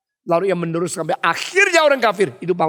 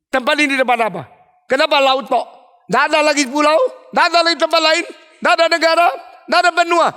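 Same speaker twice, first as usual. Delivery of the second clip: raised voice climbing higher with each question; insistent questioning; 7 semitones higher, 4 dB louder, much faster than usual. Retold or imitated. Emperor demanding answers from a farmer, increasingly insistent. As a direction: press each question harder, higher and louder.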